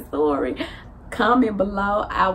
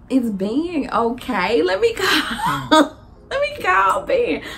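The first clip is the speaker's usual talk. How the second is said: laughing worry; 8 semitones higher, 3 dB louder, slower than usual. Retold or imitated. Worried, laughing while retelling.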